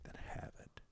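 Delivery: whispered, very soft reply; near-whispered retold answer; hushed granting. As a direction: grant it quietly, almost without voice. Retells Jesus quietly granting it.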